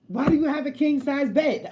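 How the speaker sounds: high, loud jealous question; mock jealous interrogation; accusing boyfriend.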